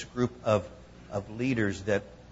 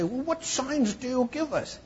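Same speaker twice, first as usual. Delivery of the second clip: mock demand; 12 semitones higher than usual. Critics' quote voiced.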